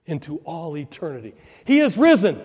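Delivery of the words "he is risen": loud, high Easter acclamation; shouted proclamation. Real, not acted. Preacher rallying the congregation on Easter, shouted proclamation. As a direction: raise your voice and proclaim it. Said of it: "Can you raise your voice this morning".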